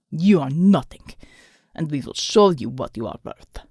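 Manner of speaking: low menacing thug. A narrator's reading. The thug threatens Alma, low and cold. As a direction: lean in and menace her.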